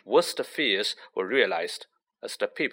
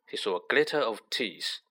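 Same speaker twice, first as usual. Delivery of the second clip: ordinary delivery, nothing marked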